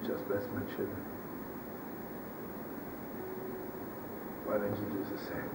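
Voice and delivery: starts firm, then sinks to a hush; fading prayer. Retold or imitated. Rebbe pleading with God at the Ark, fading to a hush. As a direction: let the plea to God die away softly.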